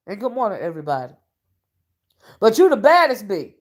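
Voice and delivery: usual speaking voice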